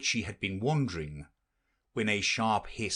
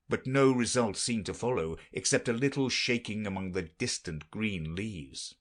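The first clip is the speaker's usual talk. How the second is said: ordinary delivery, nothing marked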